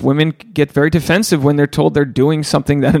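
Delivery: ordinary talk, nothing marked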